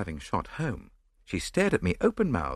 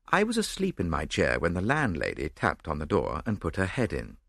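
usual speaking voice

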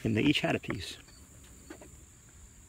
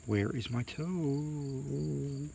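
soft, high, stretched-out eerie groan; groaning ghostly voice; 4 semitones higher, 6 dB quieter, much slower than usual. A narrator's reading. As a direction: draw the last word out like a ghost. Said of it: "it groaned".

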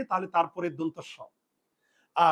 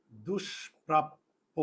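"দুষ্প্রাপ্য" very slow and softer, with pauses between syllables; broken into syllables; 4 semitones lower, 5 dB quieter, much slower than usual. Shown as a demonstration. Teacher dictates an example word to students, slowly broken into syllables. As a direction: draw out "দুষ্প্রাপ্য" piece by piece, lower and softer. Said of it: "এই যে স এর আগে দু".

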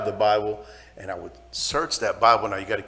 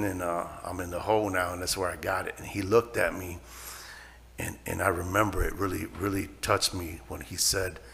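ordinary talk, nothing marked

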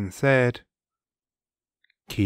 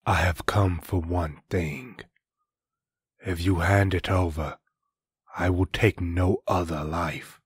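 menacing villain voice; 4 semitones lower than usual. Acted. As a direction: speak as a calm, deadly masked killer. Masked villain makes decree.

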